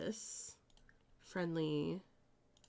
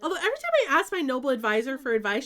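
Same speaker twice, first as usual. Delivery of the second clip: ordinary talk, nothing marked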